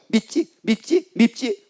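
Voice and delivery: high, quick little prods; tempting devil. Acted. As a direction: needle the listener like an acted tempter. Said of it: "마귀가 탁 와가지고 얘기합니다".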